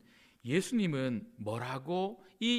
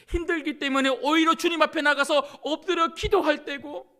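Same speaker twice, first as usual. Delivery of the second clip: fiery preaching; 10 semitones higher, 9 dB louder than usual. Acted. Preaching at full force.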